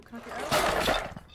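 slow, loud, breathy plea to escape; scared plea. Real, not acted. Scared player begs the game to let him out, pleading. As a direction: beg to be let out, rattled.

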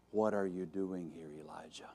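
very soft, low question, fading to a whisper; God's still small voice; small gentle voice. Acted. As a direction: ask it in a hush, the name barely breathed. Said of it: "there was that small voice".

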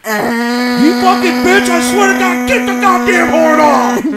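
high, loud, slow furious yell; yelling over horn. Retold or imitated. Boyfriend yelling at girlfriend over the stuck horn, loud furious yell. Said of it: "So you're yelling at her".

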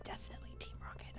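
secretive whispered aside. Real, not acted. Conspiratorial aside about JojaMart.

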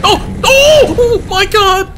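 high, loud cries of shock; startled shrieks. Real, not acted. Jump scare in cave.

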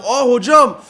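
high, loud appeal to the ref; protesting shout. Real, not acted. Player appeals to referee for a foul, loud protest.

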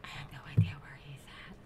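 fast, tense whisper; hiding, whisper quickly. Real, not acted. Hiding from a mob.

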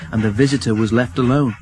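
usual speaking voice

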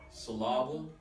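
syllable said as syl-LAH-ble; syllable with a strong, stretched LAH; stress on second syllable. Shown as a demonstration. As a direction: punch the middle of syllable. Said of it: "I moved the accent from each".

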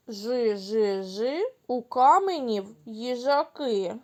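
ordinary delivery

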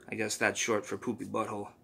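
ordinary delivery, nothing marked

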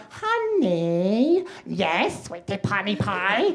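put-on drawn-out, babyish lovey-dovey talk; hammed-up squeaky baby talk; sickening baby voices. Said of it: "sickening baby voices that couples do".

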